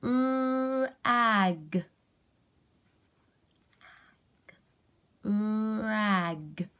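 RAG drawn out slowly, with a long held r; sounded out. Shown as a demonstration. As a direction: sound out RAG for a young child, stretching it. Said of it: "see if we can sound out this word".

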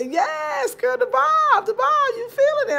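high, loud squeal over the bob; excited hype. Real, not acted. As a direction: hype up your friend's new bob. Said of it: "just got a bob here".

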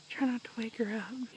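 hushed whisper; whispering over a crib. Real, not acted. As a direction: whisper so she stays asleep. Sneaking past sleeping cat.

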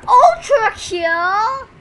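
loud, high, drawn-out shout; excited shout. Real, not acted. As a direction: stretch it out in a big high yell. Reacts to an ultra kill.

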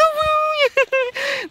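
high, loud shrieking laugh; loud, high-pitched giggling shriek; high squealing laugh. Real, not acted. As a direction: burst into a shrill squeal of laughter. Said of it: "笑ってるようにしか聞こえない".

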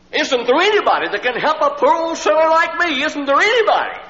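high, loud wailing cry; imitating a sobbing sinner's desperate wail; tearful plea. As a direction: cry out for anyone at all to save him. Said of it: "he looked up through his tears".

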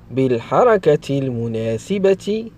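ordinary talk

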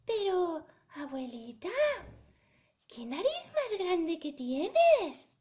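slow, swooping high amazement; hesitate, then marvel in a girl's voice; puzzled, amazed Caperucita. Acted. Caperucita noticing grandma's big nose, amazed.

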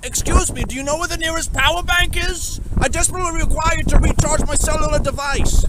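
high, loud hammed-up courtly voice; squeaky formal role-play voice; posh game character. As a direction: play an overdone polite NPC asking for help. Put-on high loud NPC voice.

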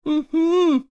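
high, loud closed-mouth mumble; gagged mumble. A narrator's reading. Hermione calls from behind the wardrobe, gagged mumble. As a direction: hum it urgently through a gag. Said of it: "came Hermione's mumble".

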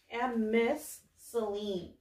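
usual speaking voice

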